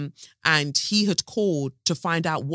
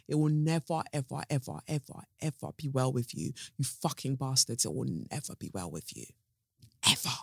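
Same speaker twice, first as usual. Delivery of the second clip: softer, seething, each ever pressed down; a real grief-filled curse that gets softer; bitter quiet curse; 3 semitones lower, 8 dB quieter than usual. Real, not acted. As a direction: spit the curse out low and bitter. Cursing the council over Grenfell.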